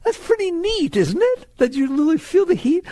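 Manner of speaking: very high, awestruck delight; excited wonder. Acted. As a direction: marvel at it in a high voice. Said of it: "looking at it saying, "That's exciting".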